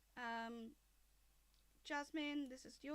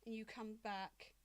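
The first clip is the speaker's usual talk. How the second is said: lightly voiced angry dragon mother; 11 dB louder than usual.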